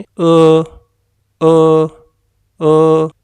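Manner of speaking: loud "अ", ain blended with alif, from the throat; Chinese e sound. Shown as a demonstration. Teacher loudly demonstrates the hard "अ" sound. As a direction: push "अ" out loudly from the back of the throat. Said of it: "आप इसको सुनने और समझने की कोशिश करें".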